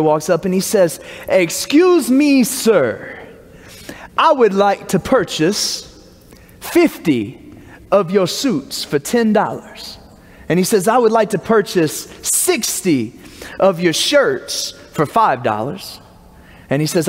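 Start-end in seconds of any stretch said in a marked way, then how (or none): 1.3-10.0 hammed-up posh purchase request; mock fancy accent. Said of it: "talk in the fanciest accent I know". 10.9-16.0 hammed-up posh purchase request; mock fancy accent. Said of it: "talk in the fanciest accent I know".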